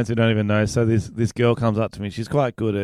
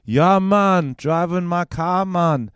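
high, slow, put-on Jamaican accent; 7 semitones higher, much slower than usual. Shown as a demonstration. Arguing the accent is harmless, demonstrates Jamaican accent. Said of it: "trying to do an accent".

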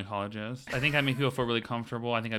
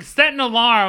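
mock user command; 10 semitones higher, 11 dB louder than usual.

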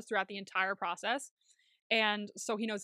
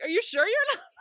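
high, loud disbelief; hammed-up incredulous outburst; incredulous question; 11 semitones higher, 7 dB louder, much faster than usual. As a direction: shout it in laughing disbelief. Retelling own incredulous reaction.

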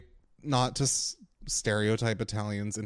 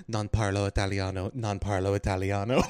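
Italian phrase; 3 semitones lower than usual. Retold or imitated. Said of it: "So I was just like".

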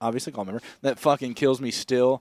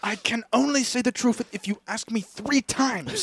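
higher, laughing character voice; higher pitched mock henchman; put-on movie voice; 9 semitones higher than usual. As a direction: play the interrogated henchman. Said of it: "Austin Powers bit".

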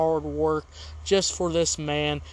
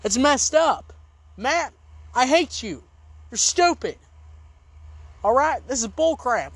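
mock angry outburst; 5 semitones higher than usual. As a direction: hurl insults at him. Insulting Matt in anger.

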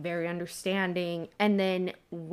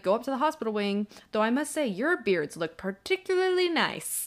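whimsical Dumbledore; 4 semitones higher than usual. Retold or imitated. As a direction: tease them with whimsical courtesy. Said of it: "He's very whimsical".

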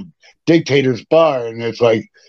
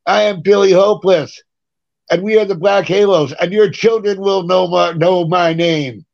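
stage proclamation; 6 semitones higher than usual. Retold or imitated. Drunk stage announcement retold.

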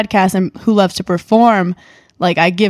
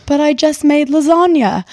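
put-on higher, slow British accent as the boyfriend; British guy impression; 6 semitones higher than usual. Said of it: "I dated this British guy".

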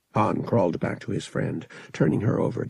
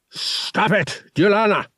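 loud, higher angry snarl; 12 semitones higher, 5 dB louder than usual. Acted. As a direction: bark the order at the Wookiee. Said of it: "the captain snarled".

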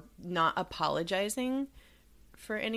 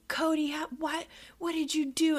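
accusing parent; 12 semitones higher than usual. Retold or imitated.

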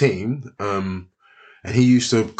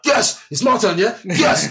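high-pitched, eager burst of excitement; hyped-up yes; 11 semitones higher, 3 dB louder, slower than usual. Retold or imitated. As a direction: retell being raring to go. Retells being hyped to walk out.

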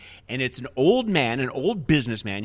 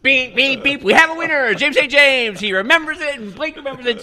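much higher, loud winner buzzer call; game-show announcer; 12 semitones higher, 7 dB louder than usual. Pretend. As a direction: announce the winner like a quiz host. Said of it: "game-show winner call".

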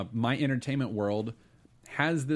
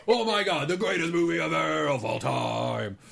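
overexcited; 5 semitones higher, 4 dB louder than usual. Retold or imitated. Mimicking Pratt's overexcited reaction.

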